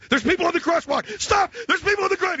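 high, loud alarm; mock panicked yell. Father yelling at son about the crosswalk, high and loud. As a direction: yell it like the kid's about to hit someone.

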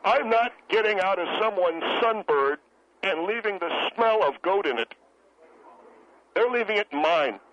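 indignant, emphatic declarations with pauses between; emotional, worked-up. Acted. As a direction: defend yourself with wounded insistence. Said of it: "You're darn right I'm emotional".

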